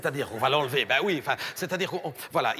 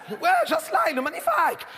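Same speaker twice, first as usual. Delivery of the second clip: very high, gushing mock Portuguese accent; Christina Cordula; 8 semitones higher than usual. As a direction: squeal with delight in a Portuguese accent. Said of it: "un, un accent portugais". (accent portugais suraigu et enthousiaste)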